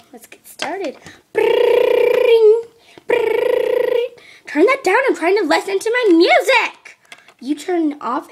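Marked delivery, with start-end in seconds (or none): 1.3-4.1 loud high rolling brrring; imitated bell ring. 4.5-7.0 high, loud yell; angry yelling. Acted. Sisters fight over music again.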